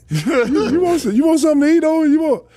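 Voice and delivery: high, loud, fast laughing question; imitating Wolf laughing; laughing mock offer. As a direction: tease through laughter.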